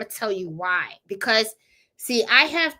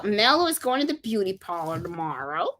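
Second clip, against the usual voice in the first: normal talking voice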